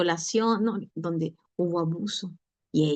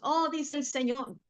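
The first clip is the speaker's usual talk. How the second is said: mock prophetic proclamation, slow and higher; proclaiming aloud; 6 semitones higher, 4 dB quieter, slower than usual. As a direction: intone it like a prophet announcing to all. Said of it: "tampoco lo podía decir a viva voz". (proclamación profética fingida, lenta y más aguda)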